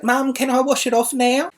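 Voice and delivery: high, lightly voiced kid calling across the yard; child calling out. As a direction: call out like a bored little girl. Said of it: "she yelled out".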